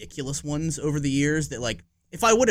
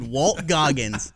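ordinary delivery

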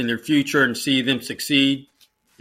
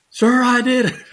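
high, louder cry of triumph; retold excited yell; 11 semitones higher, 4 dB louder than usual. Cadet bursting into the shop, high excited shout. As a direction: burst in shouting the good news. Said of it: "someone comes screaming into".

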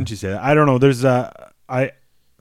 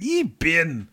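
mock French teacher; 6 semitones higher than usual. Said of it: "She's like".

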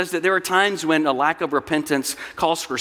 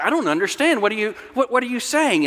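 higher-pitched bewildered questioning; confused, pitched-up protest; startled; 6 semitones higher, faster than usual. Acted. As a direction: play a startled patient, stumbling over the question. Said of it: "she's startled. She's like".

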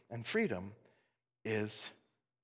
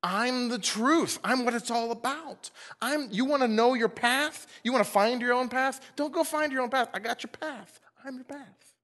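Jesus telling seekers he is their path, a light voicing; 8 semitones higher than usual. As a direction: speak as Jesus offering the path. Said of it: "What he means by that is".

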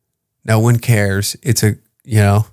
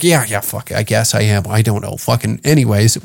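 softer, lower, bored brush-off; indifferent shrug; 3 semitones lower, 4 dB quieter than usual. Retold or imitated. Accused of racism, shrugging it off softly and bored. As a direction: mumble it like you couldn't care less.

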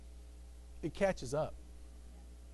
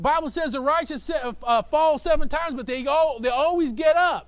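louder, higher preaching; 6 semitones higher, 7 dB louder than usual. Real, not acted. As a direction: drive it home to the crowd. Preaching climax.

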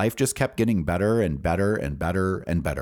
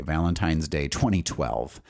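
ordinary talk

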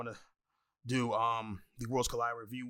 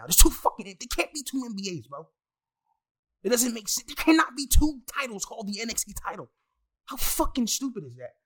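loud, high-pitched exasperated rant; worked up and indignant; getting heated; 8 semitones higher, 8 dB louder than usual. Real, not acted. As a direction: argue it hotly, voice raised and climbing. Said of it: "now I'm getting heated".

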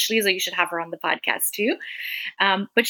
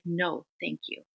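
cold, quiet, slow no thanks; irritated refusal; 2 semitones lower, 10 dB quieter than usual. Retold or imitated. Mother cutting off the volunteer, quiet and curt. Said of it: "I was so irritated".

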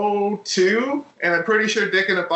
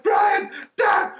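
much higher, strained mock yell; imitating excited calling; much slower than usual. Friend mimics Ted summoning Brian, in a high excited yell. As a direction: call out as if too worked up to finish. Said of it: "Ted's like".

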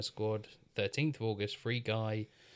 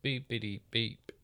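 lightly voiced beeping robot; toy robot voice. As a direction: beep like a little toy robot. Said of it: "That was a South Park joke".

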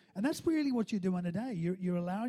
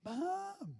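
high, drawn-out cry for Mum, kept soft; mock calling out; 11 semitones higher, 7 dB quieter than usual. Hurt child yelling out for Mum, softened mock call. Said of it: "you either yelled out".

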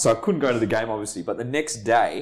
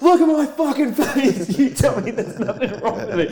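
retold furious shout, much higher and louder, slowed; screaming back; 11 semitones higher, 4 dB louder than usual. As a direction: lose it and yell back in pain and anger. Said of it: "I start screaming at him".

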